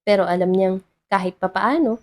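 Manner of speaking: usual speaking voice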